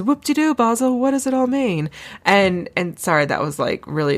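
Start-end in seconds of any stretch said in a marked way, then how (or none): 0.0-1.9 put-on high, hammy British accent as Austin Powers; bad British accent. Mocking a film scene in a bad British accent. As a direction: camp it up in an over-the-top British accent. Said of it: "really bad British accent".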